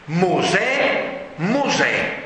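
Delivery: ordinary delivery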